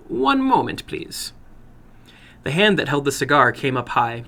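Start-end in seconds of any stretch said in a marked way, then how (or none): 0.1-1.3 put-on higher, accented interruption; lightly voiced little man stopping him; little man halts the boy, higher; foreign little man.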